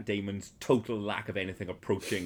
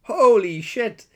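loud exclamation; 12 semitones higher, 12 dB louder, slower than usual. Real, not acted. Exclaiming at remembered shock.

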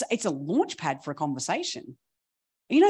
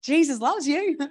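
cheery comeback; 10 semitones higher, 4 dB louder than usual. Retold or imitated. Cheery comeback to ranting man.

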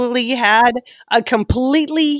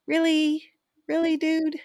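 incredulous; 8 semitones higher, 6 dB quieter than usual. Retold or imitated. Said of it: "So I'm like".

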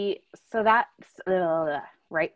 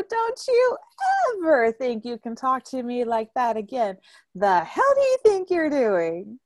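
high, raised scold; stern scolding; 4 semitones higher, 3 dB louder than usual. Retold or imitated. Aunt scolding nephew after slap, stern raised voice. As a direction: lay down the law firmly. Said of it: "I look at him and I go".

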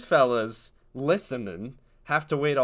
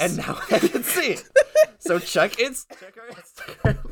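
laughing; 9 semitones higher, 5 dB louder than usual. Real, not acted. Laughing while announcing the Instagram.

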